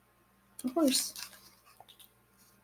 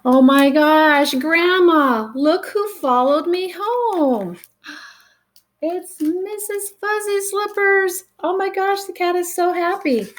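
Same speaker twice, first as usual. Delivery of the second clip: put-on high, excited child voice, slow at first; a thrilled little girl calling Grandma; 10 semitones higher than usual. Excited child finds the cat.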